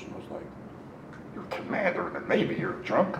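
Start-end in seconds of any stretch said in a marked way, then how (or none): 1.3-3.2 incredulous. Retold or imitated. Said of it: "Didn't say that to him".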